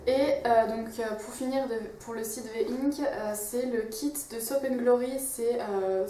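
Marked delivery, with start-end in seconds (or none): none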